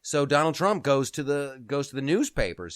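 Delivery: ordinary talk, nothing marked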